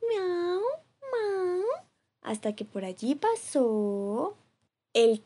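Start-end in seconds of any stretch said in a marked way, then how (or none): 0.1-1.6 high, plaintive cat meow. Acted. Cat stuck in the tree mewing high and plaintive. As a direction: mew like a scared kitten.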